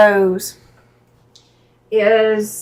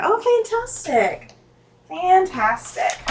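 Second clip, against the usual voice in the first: delighted; 8 semitones higher, slower than usual. Real, not acted. Delighted reaction to a chat comment.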